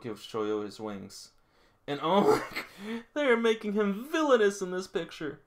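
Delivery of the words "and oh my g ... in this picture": high, loud, laughing disbelief; amused squeal over the art; laughing reaction. Real, not acted. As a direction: crack up at the picture. Laughing at a funny drawing.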